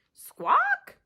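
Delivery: high loud bird squawk; gull squawk. Acted. Kelp gull answering Pip with a high squawk.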